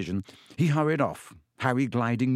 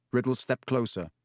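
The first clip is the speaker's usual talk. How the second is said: ordinary delivery, nothing marked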